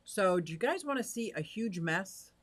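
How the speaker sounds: usual speaking voice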